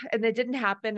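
ordinary talk, nothing marked

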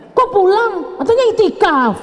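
high, loud mock nag of a wife; mock nagging wife. Wife mockingly scolding husband home early from itikaf, high and loud. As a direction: scold him like an annoyed wife, half joking.

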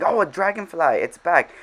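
light up at the sight; excited. Real, not acted. Spots the dragonfly again.

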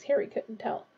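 plain reading voice

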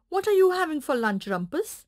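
hungry puppy asking the donkey; eager puppy voice. Acted. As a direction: rattle it off like an eager pup. Puppy asks rooster.

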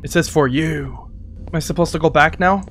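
normal talking voice

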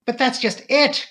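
high, loud urgent protest; cried out. A narrator's reading. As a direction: cry out in agitation. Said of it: "cried the girl".

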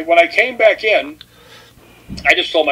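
ordinary talk

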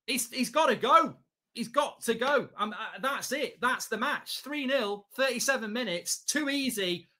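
ordinary talk, nothing marked

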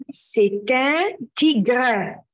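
slow, syllable by syllable; slow model answer. Shown as a demonstration. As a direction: say it slowly so they can repeat. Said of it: "كلنا نقول ورا بعض كده". (نطق بطيء وواضح للتكرار)

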